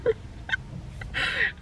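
high, quiet, airy laughter; laughing at her own meltdown; helpless laugh. Real, not acted. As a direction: crack up helplessly, high and soft.

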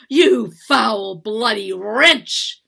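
loud, drawn-out furious insult; enraged curse. A narrator's reading. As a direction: hurl each word in rage. Mistress curses servant after note burns.

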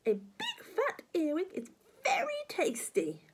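high, lip-smacking granny voice; relishing grandma. Acted. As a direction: savour every word.

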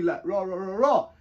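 ordinary talk, nothing marked

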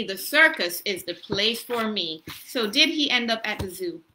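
ordinary talk, nothing marked

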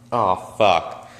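ordinary delivery

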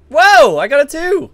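loud, high, drawn-out whoa of delight; thrilled he has the power too; excited. Real, not acted. Friend finds he can do the trick too, excited whoop.

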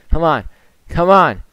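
pleading, building. Real, not acted. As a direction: push harder each time. Urging, building louder.

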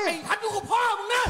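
high, loud, furious rebuke; father yelling at his defiant son; furious shout. Acted. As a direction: roar the warning at him.

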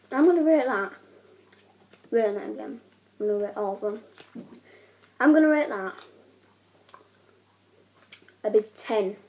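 gum in mouth; chewing between words; talking while chewing gum. Real, not acted. Seen 2: just put gum in mouth; jaw and lips show chewing while talking.

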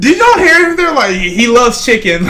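high, loud incredulous laughing; excited laughing. Real, not acted. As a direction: share the joke with the viewers. Quoting the recording kids.

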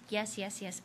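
ordinary talk, nothing marked

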